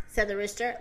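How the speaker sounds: usual speaking voice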